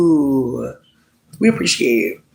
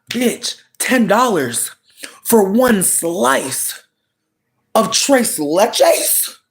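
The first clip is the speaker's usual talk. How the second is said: drawn-out disbelief; 5 semitones higher, much slower than usual. Pretend. Outraged at price, drawn out.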